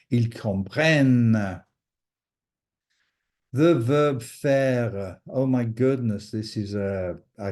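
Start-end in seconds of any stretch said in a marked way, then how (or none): 0.1-1.6 "comprennent" with a long, firm final n; emphasized N sound. Shown as a demonstration. Teacher pointing out the double N, emphasized N sound. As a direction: hold the closing n of "comprennent". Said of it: "emphasizing the N sound".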